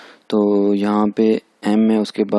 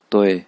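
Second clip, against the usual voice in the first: तोए as one syllable, falling; fourth-tone blend; slower than usual. Shown as a demonstration. Teacher blending initial and final into तोए, falling tone. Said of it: "हम pronounce करेंगे इसको".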